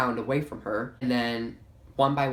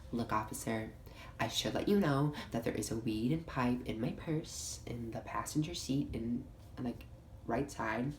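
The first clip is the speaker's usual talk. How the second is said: much softer, hushed confession; sheepish confession; 9 dB quieter than usual. Retold or imitated. Beth quietly owning up to the officer. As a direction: murmur the admission sheepishly.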